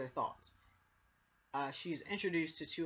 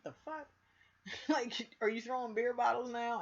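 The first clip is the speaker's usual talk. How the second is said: much higher, half-laughing disbelief; shocked disbelief; 8 semitones higher than usual. Retold or imitated. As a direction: retell his stunned reaction with a laugh. He reacts to thrown bottle.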